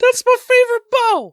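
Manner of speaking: very high squeaky complaint; whiny outraged cry. Pretend. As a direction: shriek like an upset kid. Voices Donnie's outrage.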